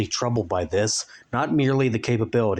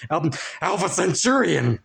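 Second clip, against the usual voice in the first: put-on higher, surprised greeting of an old friend; a startled cry of his friend's name; excited exclaim; 7 semitones higher than usual. A narrator's reading. Frank greets the runt from the cupboard, excited. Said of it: "Frank exclaimed".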